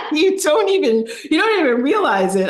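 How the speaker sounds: real laughter, words high and loud; laughing while talking. Real, not acted. Laughing at being the dinosaur.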